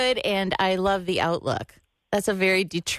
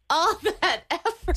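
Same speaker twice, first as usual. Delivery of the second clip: real amused laugh on "All that effort"; 8 semitones higher than usual. Real, not acted. Friend repeats, now laughing.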